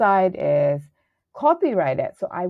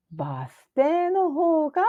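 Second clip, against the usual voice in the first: high answer; 9 semitones higher than usual. Shown as a demonstration. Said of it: "どちらの方が近いですか".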